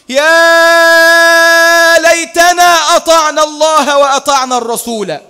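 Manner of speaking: very slow, loud, high wail; regretful cry. Acted. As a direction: stretch out the lament in anguish. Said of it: "يصرخون ويقولون".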